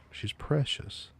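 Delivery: high, slow and doting; cutesy voice. Acted. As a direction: coo it like baby talk. Calling the streamer cute.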